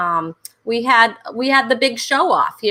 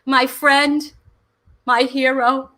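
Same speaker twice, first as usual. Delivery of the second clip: normal talking voice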